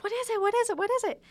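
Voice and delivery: pester, bursting to know what it is; a kid bouncing in front of the box; excited kid. Retold or imitated. Kid brother begging to know.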